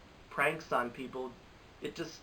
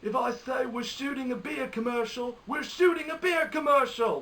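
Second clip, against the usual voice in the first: high-pitched mock shout, building louder; hammed-up movie-character yell, far above the usual voice; Sean Bean; 12 semitones higher, 6 dB louder than usual. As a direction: imitate an actor insisting at the top of the voice. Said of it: "Sean Bean saying, like".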